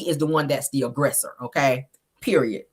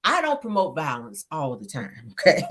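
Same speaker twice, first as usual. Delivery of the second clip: softer, lower-pitched aside; soft disclaimer; 3 semitones lower, 7 dB quieter than usual. Real, not acted. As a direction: slip in the caveat quietly. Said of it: "Let me just say it like that".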